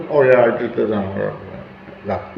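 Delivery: low, soft, slowed murmur; an evasive, half-swallowed reply. Shown as a demonstration. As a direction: mumble it like you're dodging blame. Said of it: "Don't murmur".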